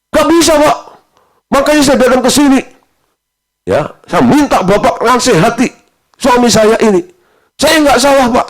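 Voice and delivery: high, loud insistent denial; wife refusing blame before the pastor, high and loud; stubborn wife. Retold or imitated. Wife stubbornly denying fault.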